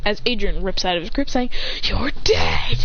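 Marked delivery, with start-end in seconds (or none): 1.8-2.9 menacing whisper. Acted. Whispered threat.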